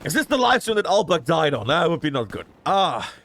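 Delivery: clogged nose from a nosebleed. Real, not acted. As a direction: talk through a bleeding, blocked nose. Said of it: "if I'm sounding like I'm crying, it's just because my nose is bleeding".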